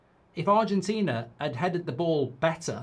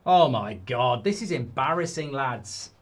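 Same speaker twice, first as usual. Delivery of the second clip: ordinary delivery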